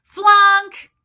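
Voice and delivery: loud, high barked verdict; sharp shouted flunk; supervisor's call. Retold or imitated. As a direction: call out the fail like a drill coach. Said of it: "the supervisor or the coach goes".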